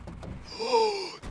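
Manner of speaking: high startled gasp; sharp gasp. Real, not acted. Gamer seeing a friend crash, startled gasp. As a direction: suck in air at the wreck. Seeing another racer wreck.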